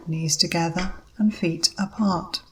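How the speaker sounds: ordinary talk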